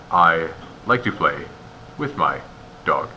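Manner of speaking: "I like to play", stop, "with my", stop, "dog"; halting chunks, "dog" left stranded after a pause; misplaced pauses. Shown as a demonstration. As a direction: break the phrase before "with" and again before "dog". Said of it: "that's pausing at the wrong spots".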